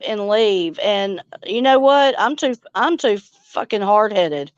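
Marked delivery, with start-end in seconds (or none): none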